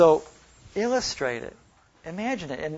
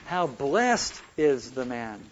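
BLESSED drawn out, falling after; a long, emphasized BLESSED in a measured reading; stress on BLESSED; 2 semitones lower, much slower than usual. Shown as a demonstration. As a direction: lean slowly on the word blessed. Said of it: "listen to me how I read it".

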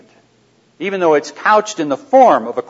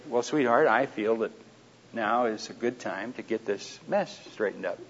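quiet, gentle, softer reply; quiet sweet-talking answer to his wife; 2 semitones lower, 10 dB quieter than usual. Shown as a demonstration. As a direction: say it softly to calm things down. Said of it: "in a soft voice, you know, quiet".

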